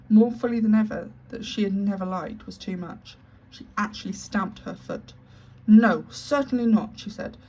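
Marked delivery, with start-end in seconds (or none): 5.7-7.0 furious refusal. Acted. Furious headmistress stamps foot.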